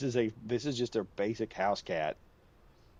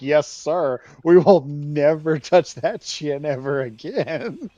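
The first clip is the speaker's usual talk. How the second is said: laughing mock obedience; 4 semitones higher, 10 dB louder, much slower than usual. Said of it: "You're like".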